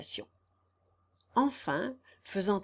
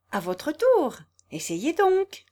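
higher and louder, eager prompt; encouraging; 6 semitones higher, 6 dB louder, slower than usual. A narrator's reading. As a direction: urge the pupil on brightly. Consuelo ermuntert ihren Schüler.

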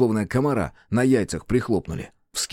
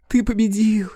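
high, slow fairy voice; pixie; 12 semitones higher, slower than usual. A narrator's reading. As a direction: languid little fairy purr. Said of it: "томный голос фейки".

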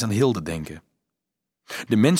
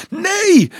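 very high, loud scream; 9 dB louder than usual. A narrator's reading. Dolf being dragged away, screaming. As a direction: scream the refusal out. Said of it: "gilde hij".

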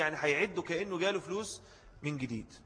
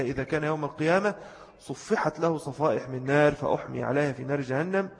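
louder, measured reading of the verse and hadith; reading scripture aloud; 3 semitones lower, 6 dB louder than usual. Acted. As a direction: declaim the text like a preacher reading from the book. (قراءة النص بصوت مرتفع ومتأنٍ)

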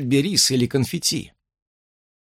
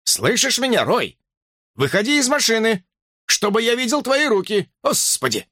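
high, raised orders; rattled police command; commanding shout; 8 semitones higher than usual. A narrator's reading. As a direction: shout him out of the car. Cop shouting at Roy.

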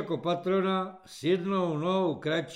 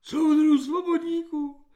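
high, loud formal address; earnest recruit; 11 semitones higher, 7 dB louder than usual. A narrator's reading. Editor-recruit addressing the corporal, earnestly high-pitched. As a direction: pipe up formally to a superior.

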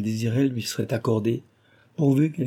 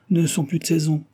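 abrupt, higher retort; brusque outcry; 6 semitones higher than usual. A narrator's reading. Husband scorns Cécile's advice, abrupt and higher. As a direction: throw the words back at her. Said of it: "s'écria-t-il brusquement".

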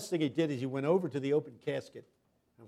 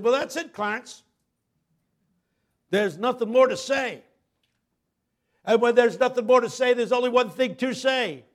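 higher and louder preacher's proclamation; preaching shout; 10 semitones higher, 12 dB louder than usual. Retold or imitated. Pastor proclaiming to the corpse, loud and high. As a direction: declare it to the whole church.

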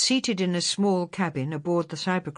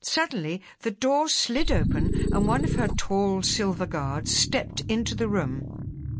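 ordinary delivery

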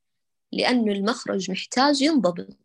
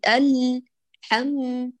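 الحم dragged very slowly with a push on the م; overstressed, stretched الحم; wrong stressed hamd; much slower than usual. Shown as a demonstration. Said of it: "فلا تقولي الحمد".

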